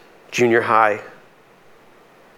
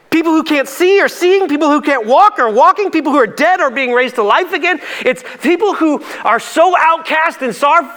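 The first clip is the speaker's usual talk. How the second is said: high, loud, building sermon voice; rising preacher's fervor; impassioned preaching; 13 semitones higher, 4 dB louder than usual. Acted. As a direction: preach it with fire, stretching key words. Preaching at full force.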